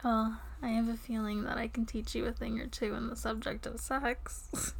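quick soft teasing hush; seductive. Acted. Seductive tease.

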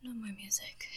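normal talking voice

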